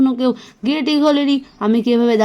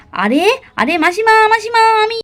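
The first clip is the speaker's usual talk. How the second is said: alarmed higher cry; panicked; 7 semitones higher, 4 dB louder than usual. Acted. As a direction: yelp to stop the blow. About to be hit. (ভয়ে চিৎকার)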